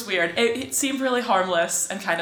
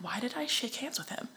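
very soft, higher whispered question; whispered self-reproach; 5 semitones higher, 13 dB quieter than usual. Retold or imitated. She sits on the train regretting it, whispered self-reproach.

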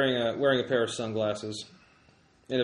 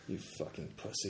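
scornful whisper; 7 semitones lower, 14 dB quieter, faster than usual. Acted. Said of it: "Ellie looks at you".

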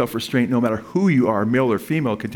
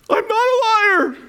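high drawn-out mock wail; crying; 4 dB louder, slower than usual. Upset lady confronting the preacher after the message, imitated crying wail. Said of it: "she's crying".

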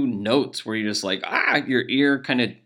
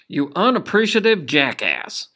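loud, higher exasperated insult; angry outburst; 7 semitones higher, 8 dB louder than usual. Real, not acted. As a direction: blurt it out in disgust. Insulting the email replier.

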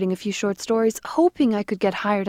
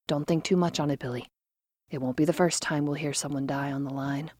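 consoling; 4 semitones lower, 5 dB quieter than usual. Acted. Lenora consoling softly.

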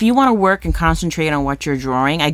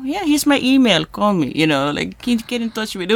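higher, wobbly attempt at a French accent; 7 semitones higher than usual. Retelling the artist agreeing to the show, shaky French accent. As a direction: badly imitate a French accent, almost Jamaican. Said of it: "almost sounds Jamaican".

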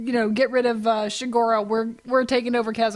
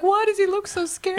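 put-on child voice; 8 semitones higher than usual. Child voice in retold memory.

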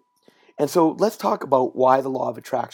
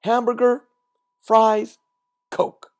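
each item punched high and loud; crisp and precise, one word at a time; demonstrating a clear drive-through order; 8 semitones higher, 6 dB louder, slower than usual. Shown as a demonstration. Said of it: "Notice how precise".

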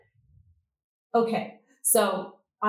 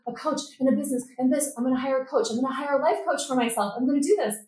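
higher, quick excited announcement; eager rush, pitched up; retold excitement; 4 semitones higher, faster than usual. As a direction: announce it with building momentum. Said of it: "I just felt this excitement".

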